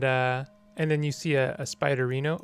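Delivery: ordinary talk, nothing marked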